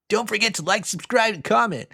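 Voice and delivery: high, bright-eyed youthful YouTuber hype; excited YouTuber voice. Shown as a demonstration. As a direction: pitch it way up like an eager young vlogger. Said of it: "excited, uh, youthful YouTuber voice".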